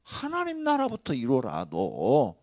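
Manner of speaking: slow, measured command; 나라부터 stretched slowly. Real, not acted. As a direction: lay down each word deliberately. Slow quiet then loud repeat.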